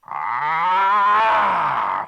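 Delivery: loud angry roaring shout; dragon roar. Acted. Woken dragon roaring at the intruder. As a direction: roar awake in fury.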